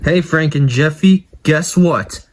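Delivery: normal talking voice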